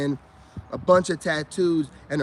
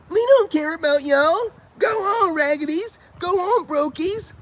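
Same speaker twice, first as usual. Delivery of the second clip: much higher, sassy mock voice; mocking women; 13 semitones higher, 4 dB louder than usual. Vlogger mocking women telling men to go, high sassy mimic. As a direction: toss it off high and dismissive.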